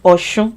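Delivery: Ọ̀ṣun said with sh, "aw-shoon"; pronounced with SH. Shown as a demonstration. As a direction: soften the s of Ọ̀ṣun to sh. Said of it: "people say Ọ̀ṣun".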